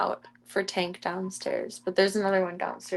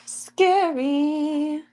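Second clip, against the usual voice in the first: long, higher, louder mock fright; drawn-out mock scary; 7 semitones higher, 3 dB louder than usual. Real, not acted. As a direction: stretch it out in a playful spook.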